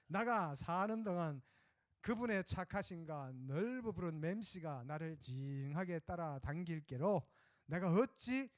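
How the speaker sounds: Psalm in hearty Jeolla dialect with drawn-out 아따. Pretend. Pastor recites Psalm 23 to the congregation in Jeolla dialect. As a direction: play it like a cheerful Jeolla villager. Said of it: "전라도".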